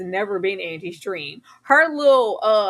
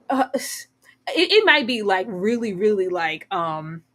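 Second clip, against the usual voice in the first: usual speaking voice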